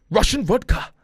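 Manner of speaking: loud, high mock Russian accent; hammed-up Russian accent; terrible Russian accent. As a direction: belt it out in a bad Russian accent. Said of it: "that was a terrible accent".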